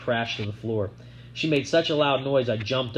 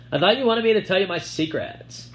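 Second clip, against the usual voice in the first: taunting; 8 semitones higher, 4 dB louder than usual. A narrator's reading. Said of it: "she taunted in a sing-song voice".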